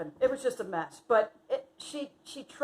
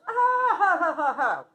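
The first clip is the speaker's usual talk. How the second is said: laughing; 7 semitones higher, 6 dB louder, slower than usual. Real, not acted. Laughing at memory.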